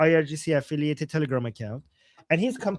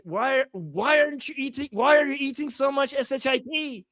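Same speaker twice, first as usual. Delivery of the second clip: indignant scolding; 6 semitones higher than usual. Retold or imitated. Translating scolding comment.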